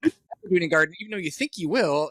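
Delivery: exasperated high protest. Retold or imitated. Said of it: "I'm like".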